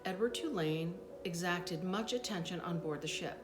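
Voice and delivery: ordinary delivery, nothing marked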